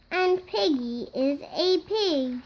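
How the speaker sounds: plain reading voice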